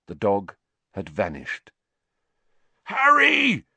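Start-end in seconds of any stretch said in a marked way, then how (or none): 2.8-3.7 high, loud anguished yell. A narrator's reading. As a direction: yell his name in anguish. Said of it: "Wood's anguished yell".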